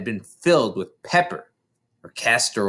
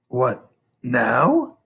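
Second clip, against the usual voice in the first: cautious. A narrator's reading. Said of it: "the boy said cautiously".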